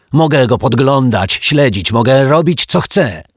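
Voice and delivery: high, loud indignant shouting; defiant yell. A narrator's reading. Mr Mietek insists he can watch his float, yelling. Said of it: "wrzasnął pan Mietek".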